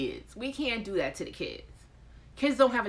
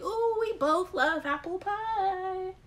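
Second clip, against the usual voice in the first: gushing couple; 12 semitones higher, 4 dB louder, slower than usual. Retold or imitated. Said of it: "Y'all been choosing people that, like".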